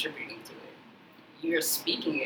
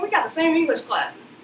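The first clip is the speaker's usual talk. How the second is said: higher, laughing through the protest; 12 semitones higher, 13 dB louder than usual. Retold or imitated. Speaker retelling her protest to friend, laughing. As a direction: protest while cracking up. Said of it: "I was like".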